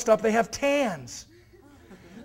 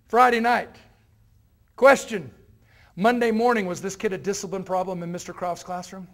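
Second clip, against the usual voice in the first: usual speaking voice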